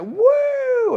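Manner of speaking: very high, loud whoop; paid crowd cheer. Retold or imitated. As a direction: whoop like a planted fan. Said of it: "they would just be like".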